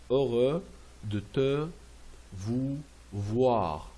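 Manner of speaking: "Heureux de te, vous, voir" word by word; a pause after each of "de te", "vous", "voir"; pronunciation model. Shown as a demonstration. Teacher showing pronunciation, spaced-out words. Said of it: "c'est pour la prononciation".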